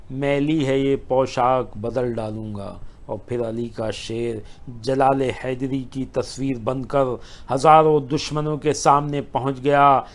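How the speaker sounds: ordinary talk, nothing marked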